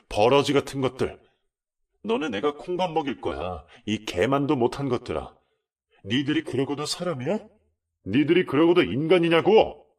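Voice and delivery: high, loud raging insults; son's enraged tirade; furious cursing. Retold or imitated. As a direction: spit abuse at the man you hate. Son beating husband, cursing.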